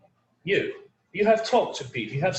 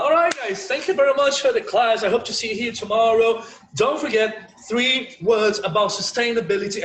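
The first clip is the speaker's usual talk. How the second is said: bright sign-off; 6 semitones higher, 4 dB louder than usual. Real, not acted. Cheerful class sign-off.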